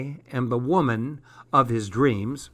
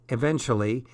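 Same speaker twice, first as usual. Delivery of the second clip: ordinary delivery, nothing marked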